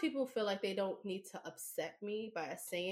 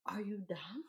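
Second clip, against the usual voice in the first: whisper; 9 dB quieter than usual. Retold or imitated. Imitated inner reaction to friend.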